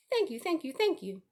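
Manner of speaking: goose thanking. A narrator's reading. The goose thanks everyone shamelessly, lightly voiced goose. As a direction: gush thanks like a proud goose. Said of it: "nodding and bowing shamelessly".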